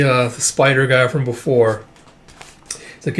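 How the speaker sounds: usual speaking voice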